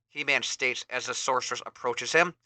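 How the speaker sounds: usual speaking voice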